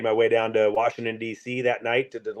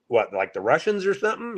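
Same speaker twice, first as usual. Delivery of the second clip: puzzled; 9 semitones higher than usual. Retold or imitated. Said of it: "And I'm thinking".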